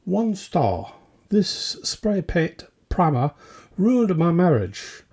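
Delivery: normal talking voice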